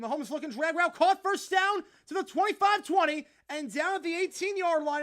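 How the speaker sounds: high, loud announcer calling a big gain; hyped sports announcer; excited play-by-play. Acted. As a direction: call the catch like a live broadcast. Play-by-play call.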